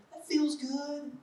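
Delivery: ordinary talk, nothing marked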